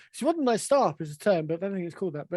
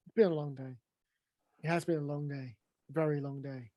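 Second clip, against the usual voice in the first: weary; 5 semitones lower, 7 dB quieter, faster than usual. Acted. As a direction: sigh through a long day. Weary repeated sighing.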